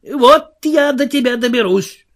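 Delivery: high, indignant character voice; angry threat. Acted. Марвел грозит невидимому голосу. (сердитая угроза высоким голосом)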